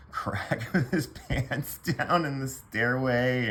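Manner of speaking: amused, laughter breaking into the talk; laughing. Real, not acted. Teller previewing wild stories for next video, laughing.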